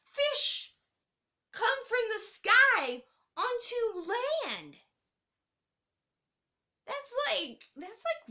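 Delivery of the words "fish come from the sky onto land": amazed. Real, not acted. Marveling at raining fish.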